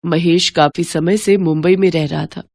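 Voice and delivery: usual speaking voice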